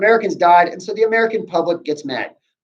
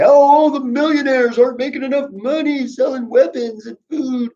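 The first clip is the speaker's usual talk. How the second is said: much higher, mock-naive voice of the public; mocking citizen voice; 11 semitones higher than usual. Teacher mocks the public to his class in a high mock-naive voice. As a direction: parody what nobody would say. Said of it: "the American people aren't gonna say".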